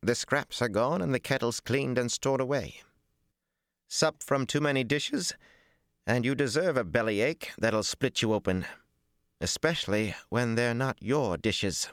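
high-pitched woman telling someone off; tart, high-voiced lecture; cross scolding. A narrator's reading. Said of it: "she scowled at him".

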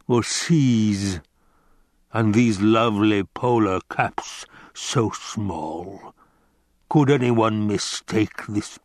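gloating, rumbling villain savouring every word; grand showman's relish, drawn-out phrases; pompous basso, slowing to linger on key words; Baron Vladimir Harkonnen. Acted. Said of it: "the basso voice rumbled".

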